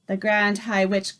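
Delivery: ordinary delivery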